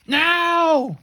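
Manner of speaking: high, loud drawn-out battle cry; rallying shout, held long; giving the order. A narrator's reading. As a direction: yell the signal to attack. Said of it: "Rebel gave the order".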